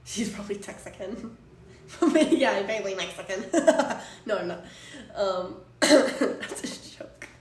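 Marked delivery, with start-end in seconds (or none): 1.4-2.2 sudden high burst of laughter; loud laugh. Real, not acted. Joke about being Mexican. 3.5-4.2 high laughing burst; loud laugh. Real, not acted. Laughing at own joke.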